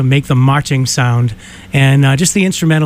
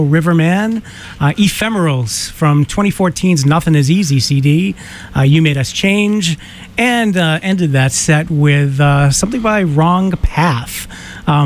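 ordinary delivery

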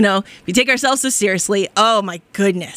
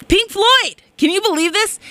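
excited exclaim; 10 semitones higher than usual. Real, not acted. Host telling listeners her dream, thrilled exclaim. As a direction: shout the band name in delight.

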